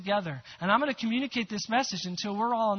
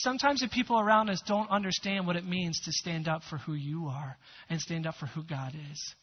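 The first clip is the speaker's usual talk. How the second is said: ordinary talk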